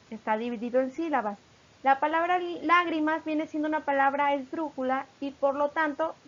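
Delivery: usual speaking voice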